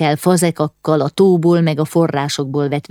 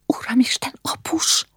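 hushed, breathy gasp of dread; horrified whisper; 9 semitones higher, 5 dB quieter than usual. A narrator's reading. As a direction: whisper it in sudden fright.

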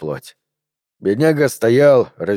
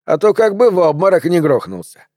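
higher-pitched mocking jibe; lazy taunt; 6 semitones higher than usual. A narrator's reading. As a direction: needle him with a smirk. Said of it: "лениво усмехнулся Эдли Маккин". (насмешливая подначка с ухмылкой)

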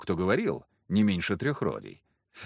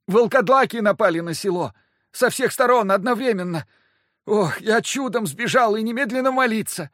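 high, loud panicked priest; 11 semitones higher, 6 dB louder than usual. Acted. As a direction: blurt it out in panic. Panicked priest reporting attack.

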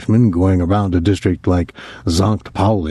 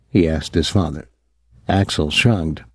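plain reading voice

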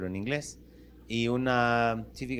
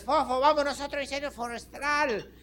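put-on little boy; 12 semitones higher than usual. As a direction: play a tiny fireman calling his dog. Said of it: "Y ahí iba otra vez".

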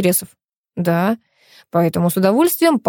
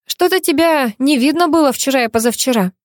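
chirping; 6 semitones higher than usual. Acted. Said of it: "щебечет Лола".

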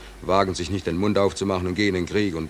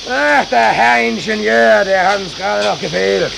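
high, loud angry yelling; furious shouting; 10 dB louder, slower than usual. Acted. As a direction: scream at the engineer. Furious quarrel in engine room.

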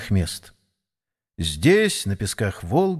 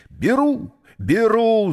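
high, urgent cry; 10 semitones higher, slower than usual. A narrator's reading. Числов jumps in to buy Mary, crying out. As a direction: burst out with it, pitch leaping up. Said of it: "закричал он".

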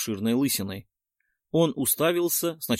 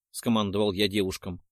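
usual speaking voice

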